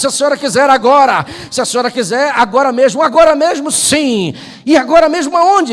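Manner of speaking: a fervent altar call; preaching at full force. Acted. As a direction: preach it and push toward the climax. Preaching builds.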